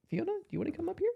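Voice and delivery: high, soft, quick pet-talk; tiny high voice inviting a pet up; coaxing a pet. Real, not acted. Host asking the pet to come up, high soft coaxing.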